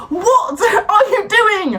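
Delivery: high, slow, drawn-out disbelief; dragging out every word in outrage; high slow exasperated yell; exasperated shout. Acted. Exasperated rant climax.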